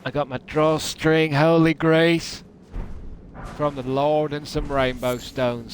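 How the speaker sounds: usual speaking voice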